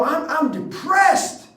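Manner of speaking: high, drawn-out declaration of misery; hammed-up despair, pitched high and slow; mock despair. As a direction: stretch it out in exaggerated self-pity. Acts out sinking into low feelings.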